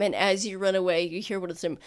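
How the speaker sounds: usual speaking voice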